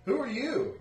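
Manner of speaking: high villager voice. Acted. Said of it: "he's like".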